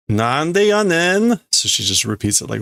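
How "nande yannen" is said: mock Osaka retort. Repeating the character's Osaka retort.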